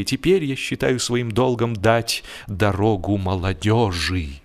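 usual speaking voice

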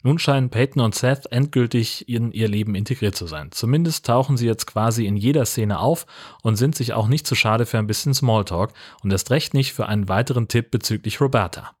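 ordinary talk

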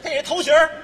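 high loud jeering; loud teasing jab at partner; mock shout. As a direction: shout the insult to the crowd. Mocking partner's hair.

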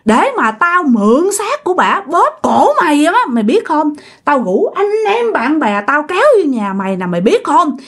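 high-pitched vengeful cursing; acted spirit's furious curse; fierce threats. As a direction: spit out hatred as a vengeful ghost. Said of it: "Làm rất là hung dữ".

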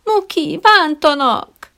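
high, slow, drawn-out tearful plea; plaintive whimper. A narrator's reading. Trainer whining to his dog for help, slow and tearful. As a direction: wail it like a child tattling. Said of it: "panaszos, síró hangon".

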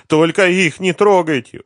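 high, loud, desperate plea; frantic pleading; begging. A narrator's reading. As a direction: implore them in a high wail. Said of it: "умолял он".

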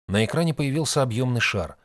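plain reading voice

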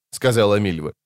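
ordinary delivery

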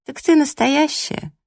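high, slow incredulous question; disdainfully amazed rival; haughty astonishment. Acted. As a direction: draw out the disbelief, pitched high. Rival sizes heroine up haughtily.

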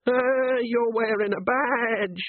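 slow, high sneering foreign voice; bogeyman sneer. Acted. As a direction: sneer it out slowly. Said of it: "it sneered".

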